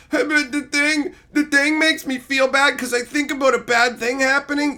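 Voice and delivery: high, whiny mock complaint; mock whiner. Mocking a fan who feels bad, whiny. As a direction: whine like a fragile viewer. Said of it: "go on the internet and go".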